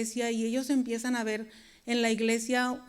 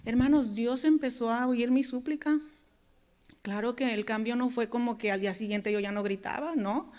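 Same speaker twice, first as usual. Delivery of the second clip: ordinary talk, nothing marked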